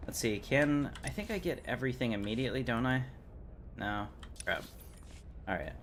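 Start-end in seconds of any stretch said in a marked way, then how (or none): none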